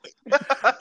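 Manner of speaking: loud high laugh. Real, not acted.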